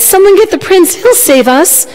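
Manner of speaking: high, urgent cry for help; mild narrated shout. A narrator's reading. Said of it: "he shouted".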